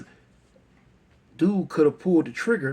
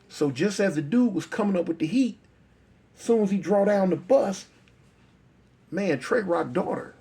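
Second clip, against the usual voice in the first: usual speaking voice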